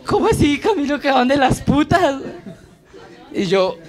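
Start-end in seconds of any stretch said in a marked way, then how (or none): 0.0-2.2 mom's shocked question. Retold or imitated.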